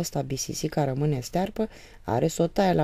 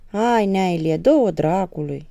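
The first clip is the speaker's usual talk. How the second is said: loud, irritated brush-off; young man answering the father, loud and annoyed; annoyed retort; 3 semitones higher, 8 dB louder than usual. Acted. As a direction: snap back, fed up with waiting. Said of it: "răspunse flăcăul supărat".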